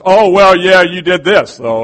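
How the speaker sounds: loud, hammed-up squabble; accusing wife. Wife bringing up past faults in a squabble, mock loud. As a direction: throw the old grievance at him loudly. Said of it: "She gets historical".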